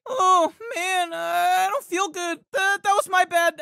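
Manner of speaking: ordinary delivery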